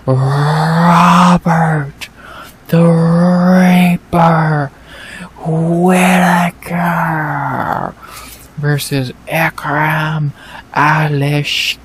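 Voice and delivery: slow, high, drawn-out fighter introduction; hammed-up main event announcer call; mock ring announcer. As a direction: draw out each name for the arena.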